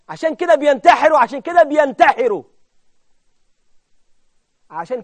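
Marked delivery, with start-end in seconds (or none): 0.1-2.4 loud, raised, repeated outcry; forceful exclamation, louder both times; impassioned preaching. Real, not acted. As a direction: hammer the conclusion at the congregation. Preacher cries out his conclusion.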